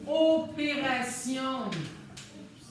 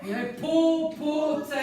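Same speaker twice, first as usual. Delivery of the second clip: drawn out; 4 semitones higher, 4 dB louder than usual. Shown as a demonstration. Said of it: "T'as raison".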